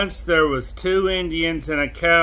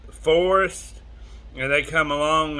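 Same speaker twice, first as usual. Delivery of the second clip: plain reading voice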